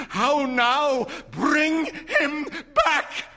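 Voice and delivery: high, loud, slow sobbing cry; wild cry. A narrator's reading. Mother crying to bring her son back, loud and weeping. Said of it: "cried the old woman".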